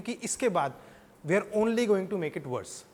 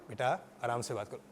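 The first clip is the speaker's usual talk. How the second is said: low, soft, gentle parent; calm a child with a hushed request; friendly tone; 8 semitones lower, 8 dB quieter than usual. Shown as a demonstration. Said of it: "आपकी tone इतनी matter करती है". (प्यार से, धीमी और नीची आवाज़ में)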